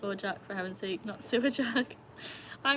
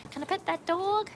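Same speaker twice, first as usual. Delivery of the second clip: put-on much higher, childlike voice; little kid voice; 11 semitones higher, 4 dB louder than usual. Streamer imitating a child asking to pet a dog, high childlike. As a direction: ask it like an eager small child. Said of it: "said in the, like".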